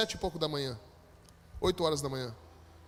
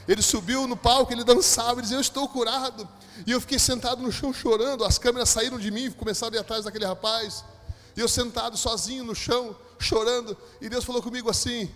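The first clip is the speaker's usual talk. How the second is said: loud, high impassioned preacher; fervent preaching; 4 semitones higher, 5 dB louder than usual. Real, not acted. Preacher tells church of the healing, fervent. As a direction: build the testimony at full force.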